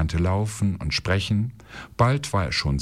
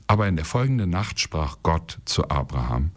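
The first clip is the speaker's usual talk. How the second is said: usual speaking voice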